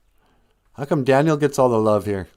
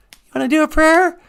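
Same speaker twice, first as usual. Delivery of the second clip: much higher put-on puppet voice; Papa Wagosh voice; 6 dB louder than usual. Papa Wagosh asking the host about the prayer, high put-on voice. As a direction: ask it as the excited puppet.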